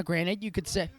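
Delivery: normal talking voice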